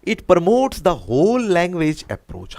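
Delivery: very slow, stretched, each word weighed; slow option reading. Shown as a demonstration. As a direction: read the option out at a crawl. Teacher reads answer option slowly.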